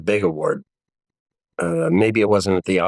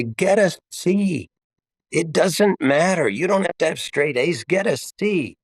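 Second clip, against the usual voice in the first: lightly exaggerated reassuring father; mock dad advice; 8 semitones higher than usual. Father telling his daughters, high, playful.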